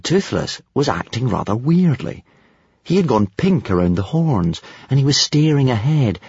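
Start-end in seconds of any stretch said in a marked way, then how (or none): none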